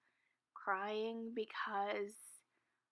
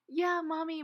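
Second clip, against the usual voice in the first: higher and louder, a sing-song mom voice; high mommy voice; 6 semitones higher, 7 dB louder than usual. Retold or imitated. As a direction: coo it higher and louder to a child. Lady on phone to child.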